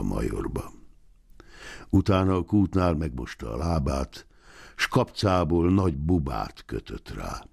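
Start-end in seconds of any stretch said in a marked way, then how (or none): none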